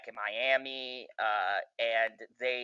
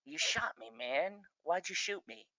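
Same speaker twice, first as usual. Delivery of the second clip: a hint of a dying man, softer and higher; dying man's whine; 6 semitones higher, 10 dB quieter than usual. A lightly voiced shot villain, softer and higher. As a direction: let it fade out like he's bleeding. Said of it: "he just, like, looks at him and he's like".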